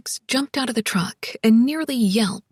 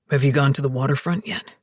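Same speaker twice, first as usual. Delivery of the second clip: low, quick man's question; deep, purposeful voice; practical Alex; 9 semitones lower, faster than usual. A narrator's reading. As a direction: ask briskly in a deep voice. Alex questions Zoe while driving.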